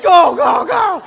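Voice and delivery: high loud urgent shout; wife yelling to drive away. Retold or imitated. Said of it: "Wendy's like".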